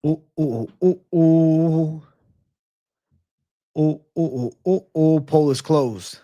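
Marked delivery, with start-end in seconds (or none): 0.1-5.2 playful ooh chant. Acted. Hyping the poll closing.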